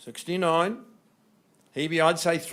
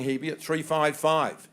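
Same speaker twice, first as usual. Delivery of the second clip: ordinary talk, nothing marked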